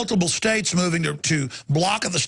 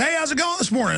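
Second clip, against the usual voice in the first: friendly greeting; 9 semitones higher, faster than usual. Retold or imitated. His friendly greeting to cops.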